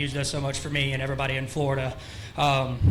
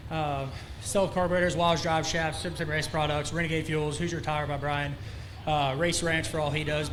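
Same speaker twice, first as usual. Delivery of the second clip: usual speaking voice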